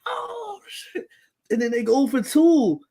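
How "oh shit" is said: squeaky-high "oh" trailing off softly; thin, high mock wail; re-enacted outcry. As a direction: replay the group's disbelief. Retold group reaction to the play.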